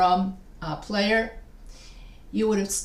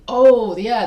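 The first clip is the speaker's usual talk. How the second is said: loud, higher "aha" moment; sudden realization; 3 semitones higher, 10 dB louder than usual. Acted. Teacher voices a student finally seeing the rhythm, loud realization. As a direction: burst out as if it just clicked.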